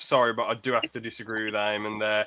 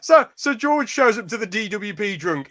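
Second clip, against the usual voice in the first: excited; 9 semitones higher, 5 dB louder than usual. Real, not acted. Sets up a comic scene.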